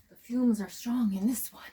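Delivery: breathy movie-quote whisper; mock whisper. Cleaning a smelly camper bathroom, joking to viewers: hushed mock whisper. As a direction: whisper it like a solemn sci-fi mentor.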